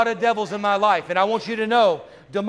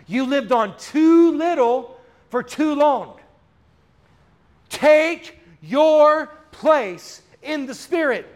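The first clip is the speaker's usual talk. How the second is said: preaching at full force; 6 semitones higher, 4 dB louder, much slower than usual. Real, not acted. Preaching climax.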